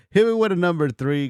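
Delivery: laughing. Real, not acted. Streamer laughing as he calls chat to vote. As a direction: call out the vote again through a laugh.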